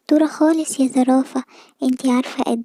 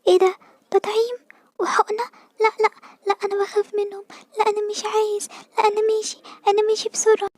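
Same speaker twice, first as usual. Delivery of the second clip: put-on scared child; 6 semitones higher than usual. Scared squirrel child refuses shot.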